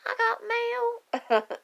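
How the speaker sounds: put-on high, slow childlike gasp of wonder; daughter's delighted surprise. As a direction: play the little girl thrilled to get mail. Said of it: "she's like".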